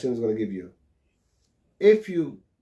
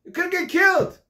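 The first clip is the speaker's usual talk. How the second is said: much higher, louder alarmed yell; screaming; 5 dB louder than usual. Retold or imitated. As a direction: yell at the kid who ran into the street. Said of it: "starts screaming at him".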